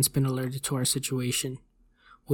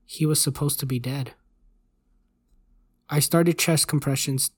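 ordinary delivery

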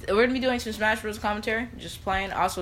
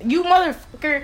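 mock angry; 10 semitones higher, 7 dB louder than usual. Real, not acted. Angry outburst at cousin.

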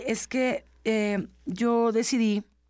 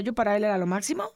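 ordinary talk, nothing marked

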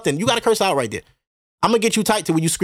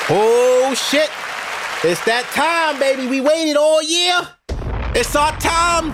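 hyped shout; 8 semitones higher, much slower than usual. Acted. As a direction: shout it out like the big day is here. Hyping up tax season.

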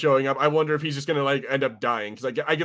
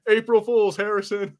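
higher, gloating tease; teasing gotcha; 8 semitones higher, slower than usual. Pretend. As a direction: rub it in to your friend. Pranking co-host, teasing.

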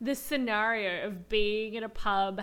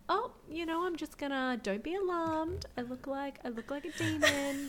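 soft, gentle mock reassurance; Giles; 3 semitones higher, 6 dB quieter than usual. Giles trying to wake up Xander without alarming him, softly reassuring. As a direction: break it to him softly and hesitantly. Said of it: "Giles as a Fieral demon trying to wake up Xander".

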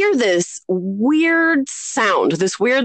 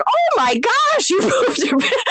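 put-on gleeful shocked kid. Kid reacting to cousin's accident, shocked and amused. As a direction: imitate a scandalized kid, laughing.

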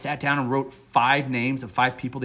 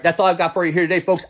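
ordinary delivery

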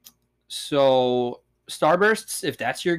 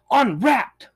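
excited exclamation; 8 semitones higher than usual. Retold or imitated. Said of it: "with an exclamation point".

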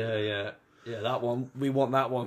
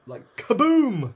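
big drawn-out mock explosion, higher and louder; kaboom explosion; 10 semitones higher, 8 dB louder, slower than usual. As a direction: blow it up with a slow booming kaboom. Imitating car exploding.

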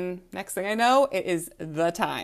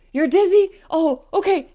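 startled, much higher and louder; panicked technician suddenly worried; alarmed; 11 semitones higher, 9 dB louder than usual. Retold or imitated. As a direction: jump in alarm at the news. Alarmed phlebotomist hears she's dizzy.

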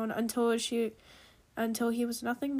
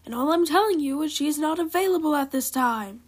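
loud, higher-pitched retort; yelling back; 4 semitones higher, 7 dB louder than usual. A narrator's reading. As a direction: yell it back over the other person. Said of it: "he yelled back".

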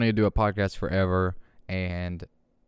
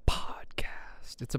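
whispered; 9 dB quieter, slower than usual. Pretend. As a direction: whisper podcast. Whispered aside in intro.

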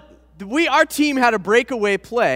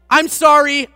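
loud apology; 5 semitones higher, 7 dB louder than usual. Retold or imitated. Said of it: "out loud".

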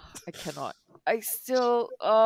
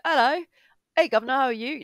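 hammed-up British greeting, higher pitch; mock British accent; 5 semitones higher than usual. Retelling Tom Holland going British on set, hammed-up British accent. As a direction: chirp it like a caricature Brit. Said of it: "full on British accent".

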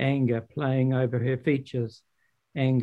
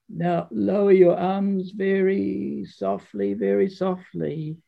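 higher, slow patient teacher; Father Frost; 5 semitones higher, slower than usual. Acted. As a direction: guide her arms down gently. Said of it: "he continued".

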